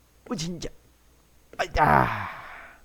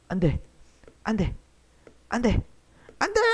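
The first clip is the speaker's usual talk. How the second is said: rising panic; 7 semitones higher, faster than usual. Real, not acted.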